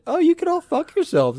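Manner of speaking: high-pitched pretend outburst; hammed-up outrage at everyone; mock indignation. Kid climbing out after being tossed in pool, mock indignation.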